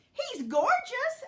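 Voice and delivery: high, loud gushing; delighted agreement. A narrator's reading. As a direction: gush in adoring agreement. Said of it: "agreed Dot".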